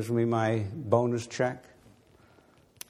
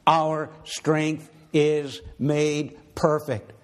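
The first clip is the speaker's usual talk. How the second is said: higher, stronger pulpit voice, slow on the big phrases; preaching; 4 semitones higher than usual. Acted. Preacher telling the congregation suffering brings strength, rising full pulpit voice. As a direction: build it like a sermon climax.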